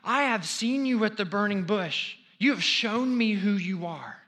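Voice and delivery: fervent preacher voicing Moses; impassioned plea. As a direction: cry out everything God has done. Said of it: "what Moses is basically saying is".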